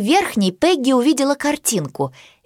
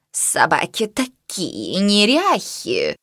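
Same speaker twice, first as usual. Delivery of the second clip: haughty cat voice; 2 semitones lower, 3 dB quieter, much slower than usual. A narrator's reading. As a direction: sneer like a fussy cat. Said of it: "фыркнула Миша".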